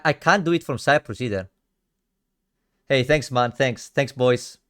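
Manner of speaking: normal talking voice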